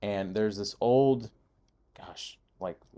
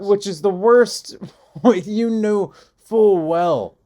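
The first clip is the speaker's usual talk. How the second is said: laughing outburst; 8 semitones higher, 9 dB louder, much slower than usual. Real, not acted. Laughing outrage at James.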